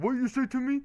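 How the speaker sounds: high-pitched mock challenge; mock confrontation. Streamer voicing a driver who overheard him, mock confrontational. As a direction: put on a high, indignant reply.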